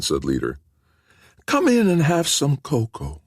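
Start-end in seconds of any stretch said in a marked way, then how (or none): 1.5-3.2 gentle, ailing woman; frail woman voice. A narrator's reading. Sick wife voiced, high.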